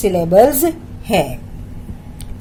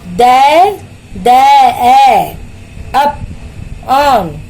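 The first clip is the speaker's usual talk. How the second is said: three isolated beats: there, अप, ऑन; slow teacher's three-part breakdown; syllable-by-syllable; much slower than usual. Shown as a demonstration. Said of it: "तीन syllables वाले देखो".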